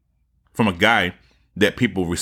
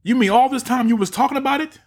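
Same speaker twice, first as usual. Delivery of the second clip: much higher, shocked mock voice; mocking Joe Rogan; faster than usual. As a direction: play the stunned podcaster, pitch way up. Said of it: "He's like".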